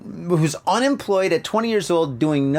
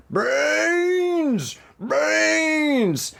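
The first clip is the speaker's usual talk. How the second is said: much higher, drawn-out zombie moan; long wailing zombie cry for brains; zombie mother; 11 semitones higher than usual. Retold or imitated. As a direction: moan it like an undead mom. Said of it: "your mother comes back to life and she's like".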